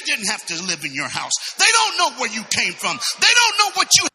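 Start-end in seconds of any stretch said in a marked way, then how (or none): 1.6-4.1 building to a high, loud preaching shout; impassioned preaching. Real, not acted. Preacher urging congregation not to be stopped, rising shout. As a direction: drive it up to full preaching force.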